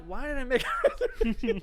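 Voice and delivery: high-pitched mock regret breaking into laughter; laughing self-mockery. Diner to self in empty restaurant, laughing self-mockery.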